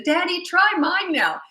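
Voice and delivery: put-on high, slow childish plea; small daughter coaxing dad, high and slow; little girl voice. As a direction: ask sweetly like a small child.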